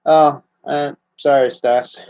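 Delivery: ordinary delivery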